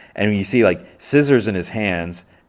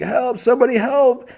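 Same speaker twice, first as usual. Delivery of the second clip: mock cry for help; 3 dB louder than usual. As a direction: call out in a put-on high voice. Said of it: "and then he calls out".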